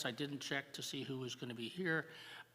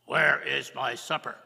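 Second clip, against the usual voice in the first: loud, gruff demand; loud hungry husband; 14 dB louder than usual. Acted. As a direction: bark for supper. First man demands again.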